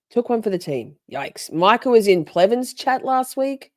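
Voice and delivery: usual speaking voice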